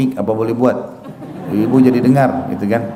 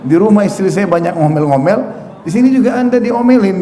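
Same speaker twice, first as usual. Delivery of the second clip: higher-pitched acted grumble; complaining husband; 7 semitones higher than usual. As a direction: lightly act out an aggrieved complaint. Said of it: "sahabat mau mengeluh". (menirukan suami mengeluh)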